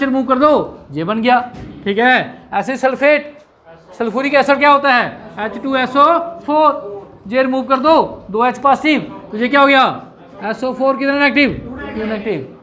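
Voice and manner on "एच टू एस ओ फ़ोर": drawn out symbol by symbol; slow dictation. Shown as a demonstration. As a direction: spell the formula slowly for the class. Teacher dictates formula. (धीमे से formula बोलते हुए)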